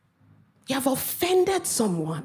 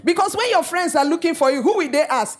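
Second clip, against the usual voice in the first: mock parent threat; 5 semitones higher, faster than usual. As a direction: rattle off a playful parental threat. Imitating stern African parent.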